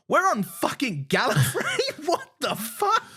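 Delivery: high, loud, slowed disbelief; shocked realisation. Retold or imitated. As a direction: yell it like the twist just hit you. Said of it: "I went".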